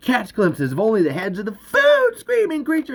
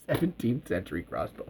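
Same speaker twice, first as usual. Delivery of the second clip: usual speaking voice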